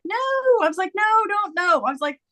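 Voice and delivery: high-pitched mock refusal; retold protest. Widow refusing her husband's reincarnation, in comic protest. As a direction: plead it away, half laughing.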